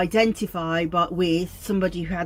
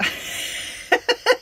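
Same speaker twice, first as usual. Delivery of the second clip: high airy laugh at her failed accent; breathy self-mocking giggle; laughing at herself. Real, not acted. Laughing after botching the Scottish accent. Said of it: "it's not getting any better".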